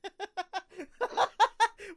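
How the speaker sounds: can't hold in the laugh; burst of laughter. Real, not acted. Friend hears the phrase enlarged foot, real burst of laughter. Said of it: "Did you just say an enlarged foot?".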